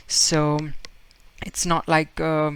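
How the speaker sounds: usual speaking voice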